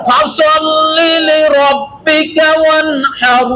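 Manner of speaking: slow chanted tajweed recitation; Quran recitation. Shown as a demonstration. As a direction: recite slowly with tajweed, holding the vowels. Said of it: "আরেকবার বলেন". (ধীর তাজবীদসহ তিলাওয়াত)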